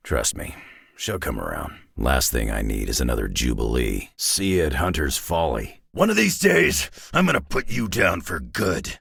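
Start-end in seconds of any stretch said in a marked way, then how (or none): 5.9-9.0 threatening shout. Acted. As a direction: yell the threat, then bear down. Threat in combat.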